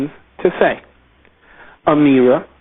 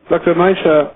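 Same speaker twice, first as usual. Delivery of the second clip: ordinary delivery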